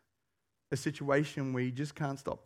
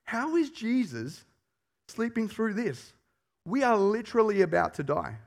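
alarmed disbelief; 5 semitones higher than usual. Retold or imitated. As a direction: voice the disciples' panic. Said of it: "They're thinking".

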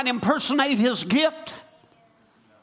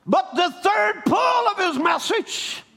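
preaching at full force; 9 semitones higher than usual. Acted. Preacher to congregation, calling them back to Christ, full force preaching. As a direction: rise up and preach it out to the church. Preaching climax on the prophet's ministry.

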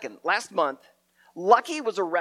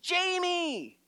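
high mock yell of a name from afar; faraway mother calling a child; distant yell; 12 semitones higher than usual. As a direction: call the name out like a mom down the street. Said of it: "I hear some lady yell".

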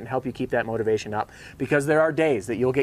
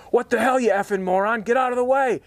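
angry yell; 10 semitones higher than usual. Retold or imitated. Canoe man cursing the approaching canoe, high mock yell. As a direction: bellow at the boat in mock fury. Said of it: "this guy goes".

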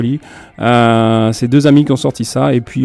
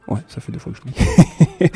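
laughing; 9 semitones higher than usual. Real, not acted. Host laughs at repeating himself.

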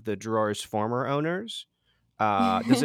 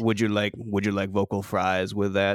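FM DJ voice; 8 semitones higher than usual. Shown as a demonstration. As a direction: croon like a late-night DJ. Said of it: "the F M D J voice".

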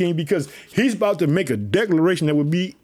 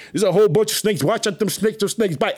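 ordinary delivery

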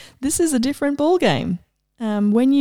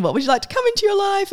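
much higher, bright and inviting; generous universe offering; 9 semitones higher, much faster than usual. Pretend. The universe offering her possibilities, high and inviting. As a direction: hold out gifts like a shopkeeper. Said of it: "there's this energy of".

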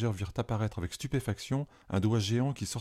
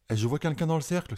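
higher, excited exclamation; exclaiming; 6 semitones higher than usual. A narrator's reading. Boy spots someone inside the book's circle, excited exclamation. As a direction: blurt out the discovery, thrilled. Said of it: "s'exclama Pépito".

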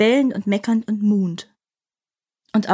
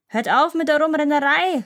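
elephant yelling; 6 semitones higher than usual. Acted. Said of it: "schrie er".

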